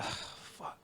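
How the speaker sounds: voiceless, drawn-in whispered curse; retold dread. Employee thinking he was getting in trouble, whispered dread. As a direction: let it out as a hushed breath. Retelling fear of being in trouble.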